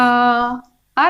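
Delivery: आ held very long and slow; drawn-out vowel. Shown as a demonstration. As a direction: stretch आ out slowly to show its length. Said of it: "समय ज्यादा लग रहा है". (आ को धीरे-धीरे लंबा खींचकर)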